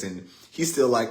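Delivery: normal talking voice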